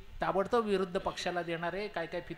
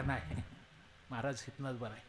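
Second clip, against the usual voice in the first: timid; 5 semitones lower, 9 dB quieter than usual. Acted. Govinda timidly refuses.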